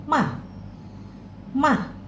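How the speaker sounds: ma dropping fast; fourth tone ma. Shown as a demonstration. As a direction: snap ma down quickly. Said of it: "turun dan juga dia agak cepat".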